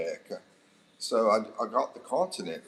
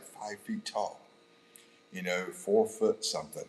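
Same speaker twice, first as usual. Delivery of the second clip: ordinary delivery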